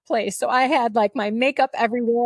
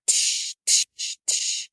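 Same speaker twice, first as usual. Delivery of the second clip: soft unvoiced tsh of drops landing; breathy drip sounds, getting quieter; tear drops hitting paper; 4 dB quieter, slower than usual. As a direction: imitate tears splashing on paper, fading. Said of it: "You can hear them hit my paper".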